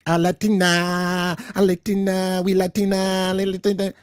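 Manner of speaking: sneering imitation of a Puerto Rican accent; mock Puerto Rican accent. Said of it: "using her hard Puerto Rican accent".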